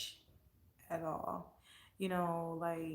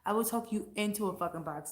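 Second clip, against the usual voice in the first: normal talking voice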